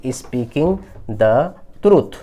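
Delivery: very slow, each word spaced out; slow dictation. Shown as a demonstration. Teacher dictating the English answer to students, very slow. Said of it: "तो क्या हो जाएगा जी".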